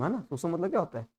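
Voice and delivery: normal talking voice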